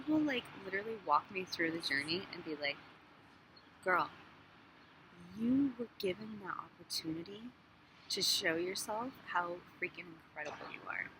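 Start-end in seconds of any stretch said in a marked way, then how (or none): 3.8-11.1 soft, hushed voice of a gentle God; tender whispered reassurance. Pretend. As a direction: whisper it like loving encouragement. Voicing God reassuring her.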